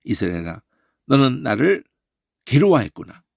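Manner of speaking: ordinary talk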